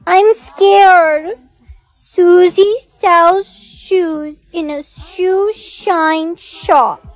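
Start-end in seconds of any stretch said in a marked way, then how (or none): 1.9-7.0 slow, careful tongue twister; careful slow recital. Acted. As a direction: say each word very slowly. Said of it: "you need to say it very slowly".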